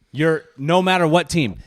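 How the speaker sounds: ordinary talk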